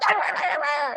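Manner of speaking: high-pitched gibberish freak-out noise; wordless hammed-up outburst of babble; mock freak-out. Dater spills a glass of water, mock freak-out. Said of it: "you freak out".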